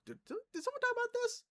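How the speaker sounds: very high, quick stammering imitation; put-on high-pitched interrupting voice; mock clueless blurt. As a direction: squeak it out fast like an oblivious kid. Imitates Ben blurting out while forbidden.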